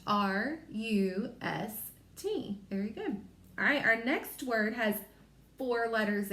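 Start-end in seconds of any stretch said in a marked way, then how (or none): 0.1-2.6 slow letter-by-letter spelling; spelling slowly. Shown as a demonstration. Teacher spelling for young learners, slow letters. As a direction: spell it out one letter at a time. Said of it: "So we spell rust".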